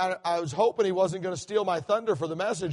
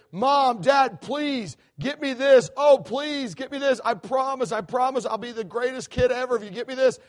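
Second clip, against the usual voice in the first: put-on higher, louder pleading kid voice, stretched out; begging child; 3 semitones higher, 3 dB louder than usual. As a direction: whine like a kid begging for a present. Child begging parents for gift.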